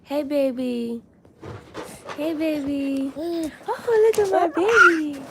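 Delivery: high, gushing coo. Real, not acted. Mother greets her one-year-old, high doting coo.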